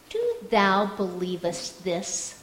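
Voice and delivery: lightly imitated English accent, low and measured; low, understated English accent. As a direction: pose the question in a hint of an English accent. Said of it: "in his thick English accent".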